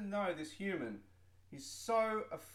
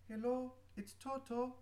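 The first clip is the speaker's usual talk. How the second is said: higher put-on caller voice; 8 semitones higher than usual. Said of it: "a paid actor".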